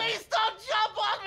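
high, drawn-out panicked cry; terrified pleading. Acted. Accountant begs the man not to jump on him, terrified. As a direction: beg shrieking for mercy.

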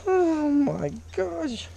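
very high amazed exclaim; excited. Real, not acted. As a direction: squeal in disbelief at its size. Marvels at big fish.

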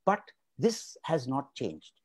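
normal talking voice

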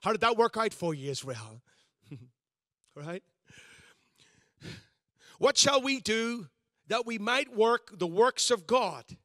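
ordinary delivery

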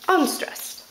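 normal talking voice

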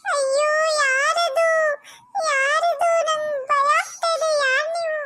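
put-on half-crying, scared kid; frightened whimper. Scared boy asks the ghost who he is, teary. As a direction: whimper who's there.

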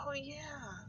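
small, high, very soft voice; retold realization. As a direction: say it softly, as if it just dawned on you. Acts out own sheepish realization.

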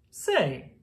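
usual speaking voice

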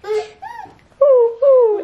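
high playful owl hoot. Retold or imitated. Mom imitates owl for toddler.

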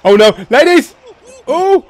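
normal talking voice

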